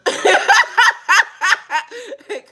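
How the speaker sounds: high cackle falling away; real high cackle; loud high laugh. Real, not acted. Laughing after her acted quote.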